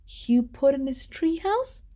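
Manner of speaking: plain reading voice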